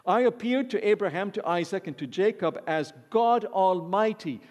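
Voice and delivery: preacher voicing God reading Exodus; scripture reading. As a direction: proclaim God's words to the congregation. Reads God's words from scripture.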